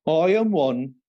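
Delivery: broad put-on Birmingham accent. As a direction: recite Shakespeare as a Brummie. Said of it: "that you're from Birmingham".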